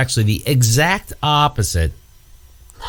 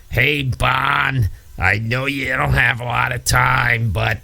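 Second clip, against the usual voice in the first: slow, drawn-out put-on voice; police chief impression; slower than usual. Chief coming in to see Barney Miller, drawling impression. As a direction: imitate a sitcom chief dropping in. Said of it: "that chief that used to come in and say".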